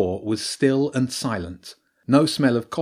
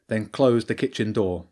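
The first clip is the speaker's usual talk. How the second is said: ordinary delivery, nothing marked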